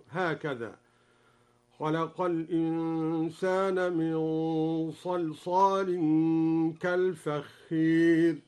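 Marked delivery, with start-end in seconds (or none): 1.8-8.4 "كالفخير" with the long a tilted to ee before the ra; slow recitation, bend the alif of "كالفخير" toward ee; imala. Shown as a demonstration. Teacher showing al-Duri's reading, imala on "كالفخير". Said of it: "قرأ الدوري بإمالة الألف قبل الراء".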